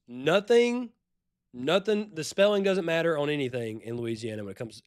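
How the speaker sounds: usual speaking voice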